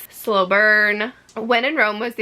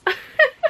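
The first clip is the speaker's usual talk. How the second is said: laugh. Real, not acted. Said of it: "excuse my frog voice".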